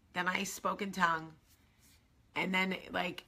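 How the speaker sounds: usual speaking voice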